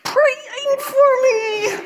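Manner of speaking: squeaky high, slow, breaking into laughter; laughing disbelief. Retold or imitated. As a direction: mad turning into a laugh mid-line. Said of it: "I start out mad".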